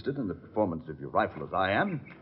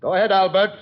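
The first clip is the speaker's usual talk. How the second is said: calling out; 8 semitones higher, 9 dB louder, faster than usual. Acted. Calls order to distant henchman.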